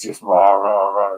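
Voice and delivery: mock gruff man's muffled voice. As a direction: growl out rah rah like a man talking through a wall. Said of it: "this guy's real gruff voice".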